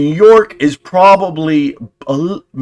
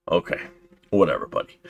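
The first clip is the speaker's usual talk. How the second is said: dismissive; 9 semitones lower, 8 dB quieter, faster than usual. Real, not acted. Dismissing the news report.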